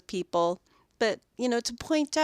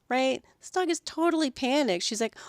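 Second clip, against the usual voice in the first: ordinary talk, nothing marked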